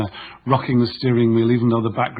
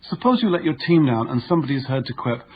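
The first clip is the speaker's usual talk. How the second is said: usual speaking voice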